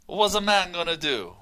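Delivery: laughing through a mock Italian accent; half-laughing Italian shrug of a line. Co-host reads a listener's email, laughing, in a mock Italian accent. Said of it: "types in the Italian accent".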